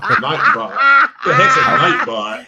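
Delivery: high, slow, laughing question; puzzled and cracking up; laughing bafflement. Real, not acted. Host cracking up at guest replying to a bot.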